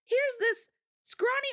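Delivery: high, drawn-out imitated enthusiasm; eager amazement, imitated; so excited. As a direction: act out excited locals, pitched high. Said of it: "everyone else was so excited".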